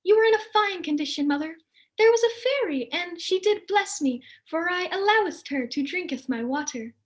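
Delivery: put-on a chirpy goody-goody girl; sweet daughter. Angelica apologizes to her mother, high and prim.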